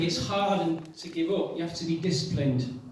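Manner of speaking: ordinary talk, nothing marked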